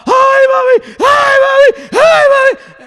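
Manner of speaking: high, loud hammed-up wail for mami; mock wailing. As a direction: imitate a mourner crying over mami. Mourner wailing at funeral.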